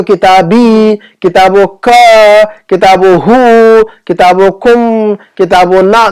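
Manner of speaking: "किताबो का" with a long, held "का"; drawn-out endings. Shown as a demonstration. Teacher showing students the attached pronouns, endings held long. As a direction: stretch the last syllable of "किताबी", "किताबो का", "किताबो ना".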